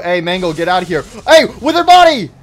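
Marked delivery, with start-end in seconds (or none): 1.3-2.3 high, loud yell at the animatronic; startled yell. Real, not acted. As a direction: shout him off, caught by surprise. Yelling at animatronic in game.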